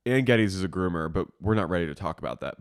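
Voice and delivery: conspiratorial. Retold or imitated. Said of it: "someone's gonna be like".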